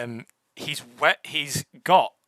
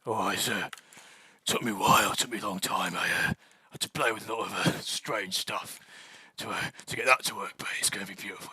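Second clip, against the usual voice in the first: lower, gruff and pleased with himself; deep gravelly voice; 4 semitones lower, faster than usual. Acted. Dwarf boasting about the caged creature, lower gravelly voice. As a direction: rumble it out like a proud craftsman. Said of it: "the other voice says".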